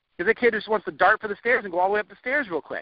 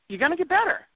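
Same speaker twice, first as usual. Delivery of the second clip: higher, lifted promise rising at the end; higher encouragement; 9 semitones higher than usual. Shown as a demonstration. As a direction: say it again much higher, rising hopefully.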